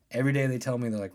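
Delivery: ordinary talk, nothing marked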